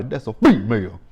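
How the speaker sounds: loud movie-line imitation; Craig from Friday. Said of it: "Craig from Friday".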